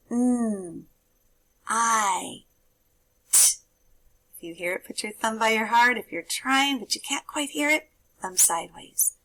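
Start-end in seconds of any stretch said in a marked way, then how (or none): 0.1-3.6 "Mm, I, t" as three separate sounds; sounding out words. Shown as a demonstration. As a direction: segment "Mm, I, t" sound by sound with pauses. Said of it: "I'm going to say some sounds".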